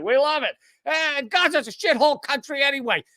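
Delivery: high mock Trump voice; Trump impression. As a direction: ham up a Trump brag. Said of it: "in exactly that accent".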